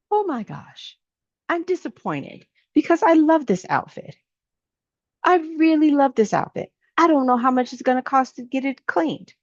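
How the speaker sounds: high, flustered and dismayed; high dismayed rant about a ruined outfit; upset splash victim. Acted. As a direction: fuss over the ruined outfit, pitched well up.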